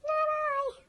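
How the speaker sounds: very high squeaky rat voice; squeaking rat. A narrator's reading. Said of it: "the rat with a squeak said".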